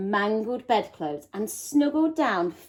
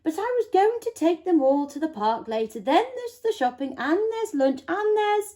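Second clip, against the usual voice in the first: fretful mum; 9 semitones higher, faster than usual. A narrator's reading. Mother fretting over chores.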